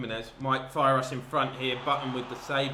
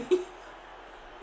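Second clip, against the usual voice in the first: delighted laugh. Real, not acted. Laughs after goal.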